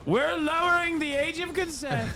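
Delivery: high, put-on Trump voice; imitating Trump. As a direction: play Trump announcing it to the crowd. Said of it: "and was like".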